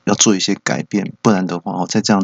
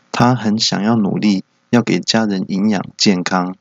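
ordinary talk, nothing marked

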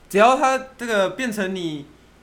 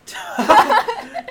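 high burst of laughter; 6 dB louder than usual. Real, not acted.

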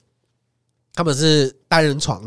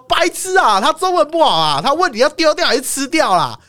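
shouted out much higher; yelling across; 11 semitones higher than usual. Retold or imitated. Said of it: "我就在那边大喊说". (在那边大喊)

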